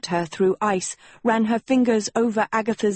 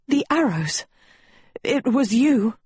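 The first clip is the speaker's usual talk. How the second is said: higher-pitched, startled accusation; stammering; 5 semitones higher than usual. A narrator's reading. Agatha accusing Sophie as she crawls back, stammering. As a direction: voice lifted in mild alarm. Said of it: "Agatha stammered".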